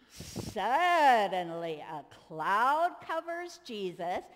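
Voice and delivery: slow, drawn-out dramatic announcement; dramatic storytelling. A narrator's reading. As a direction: make the children hold their breath.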